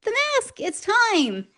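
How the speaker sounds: high, delighted exclamation; excited. Real, not acted. Host cheering the mask's appearance to sweetie, excited. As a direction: pitch leaps up in gleeful announcement. Excited reply to partner's answer.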